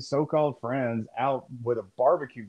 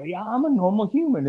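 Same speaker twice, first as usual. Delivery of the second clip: lightly put-on higher voice, insisting on normality; posing as human; 8 semitones higher, 4 dB louder than usual. Mocking Zuckerberg's attempt to seem human, higher mock-normal voice. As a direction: act a robot or alien passing as a person. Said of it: "That's the most robot shit".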